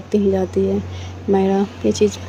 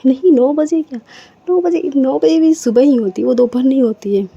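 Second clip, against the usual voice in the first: high, loud playful protest; excited protest; 5 semitones higher, 7 dB louder than usual. Acted. Aditi protesting to Myra about the time, high and loud. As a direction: insist louder and higher in mock outrage.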